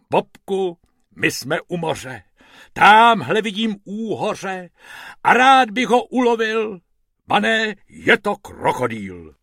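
reciting a little verse; rhyming rabbit. Acted. Bob turns poet by the pool, high and lilting.

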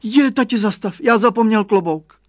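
put-on high, loud alarmed child's cry; boy yelling he forgot his hat; child's high shout. A narrator's reading. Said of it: "vykřikl".

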